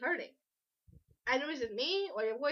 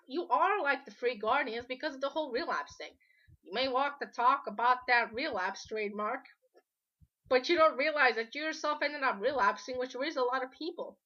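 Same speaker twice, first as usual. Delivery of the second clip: ordinary delivery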